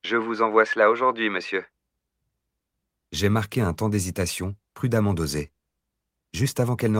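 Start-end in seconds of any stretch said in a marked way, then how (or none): none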